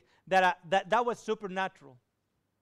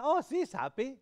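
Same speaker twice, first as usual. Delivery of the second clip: happy exclaim; 5 semitones higher, 4 dB louder than usual. Retold or imitated. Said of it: "I said".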